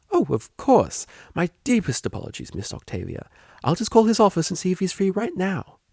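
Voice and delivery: bright, high, eager helper voice; eager receptionist. Acted. Receptionist gushing to Octavia, high and eager. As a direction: gush like an overeager receptionist.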